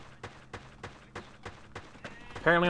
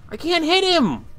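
frustrated; 11 semitones higher, 4 dB louder than usual. Real, not acted. Frustrated, can't hit enemy.

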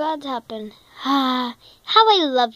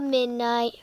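normal talking voice

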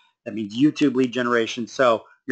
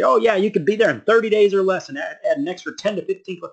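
mock guru pitch, higher-pitched; overpromising guru; 7 semitones higher than usual. Coach mocking gurus who promise fast results, overpromising guru voice. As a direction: toss off a big promise, mimicking a hype seller. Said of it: "one of those gurus that's gonna promise".

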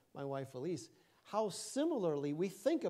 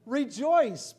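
loud, high, ringing command; emphatic exhortation; 8 semitones higher, 13 dB louder than usual. Acted. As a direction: proclaim it at full force to the congregation. Preacher hammers the repeated command.

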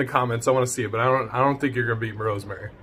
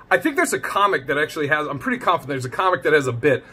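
normal talking voice

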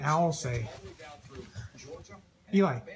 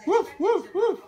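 dog barking imitation; 14 semitones higher, slower than usual. Shown as a demonstration. Animal sounds for toddler.

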